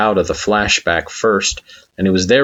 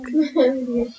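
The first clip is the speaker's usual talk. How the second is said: high, breathy little giggle; mischievous giggle; 5 dB quieter than usual. A narrator's reading.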